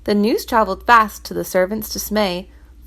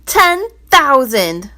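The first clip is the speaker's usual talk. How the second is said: Ten thousand, slow and louder; loud answer; 5 semitones higher, 7 dB louder, much slower than usual. Acted. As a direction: announce Ten thousand heavily. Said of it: "the treasurer's answer was loud".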